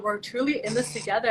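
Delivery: normal talking voice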